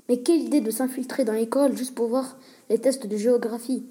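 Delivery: ordinary delivery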